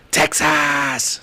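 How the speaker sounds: imitating a man's excited outburst; excited shout. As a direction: burst out with it like the winning answer. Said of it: "he was excited".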